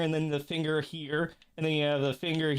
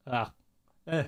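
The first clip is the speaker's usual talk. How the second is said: sputtering around a stray hair; halting over a hair on the tongue; hair in mouth. Real, not acted. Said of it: "I got a hair in my mouth".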